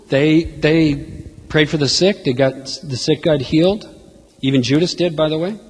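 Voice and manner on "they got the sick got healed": usual speaking voice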